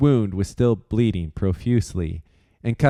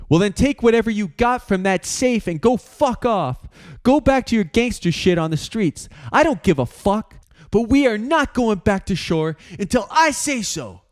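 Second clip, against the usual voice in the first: higher, hard and final; angry dismissal; 12 semitones higher than usual. Acted. Aiden telling George off over the shoulder, angry. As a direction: tell him to get lost.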